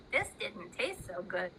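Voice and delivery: low, soft sickly murmur; queasy crow thought. Acted. Crowley thinks after swallowing the pill, low and queasy. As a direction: mutter it like a bird feeling ill. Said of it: "Crowley thought to himself".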